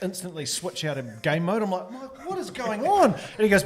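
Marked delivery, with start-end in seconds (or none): 1.9-3.1 startled. Retold or imitated. Player startled by coach speech.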